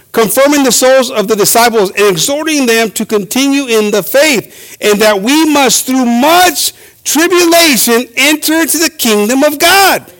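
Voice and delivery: ringing pulpit reading; preaching. Acted. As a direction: proclaim the verse to the congregation, stretching it out. Reads scripture while preaching.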